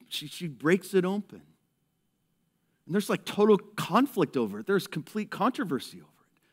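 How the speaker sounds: ordinary delivery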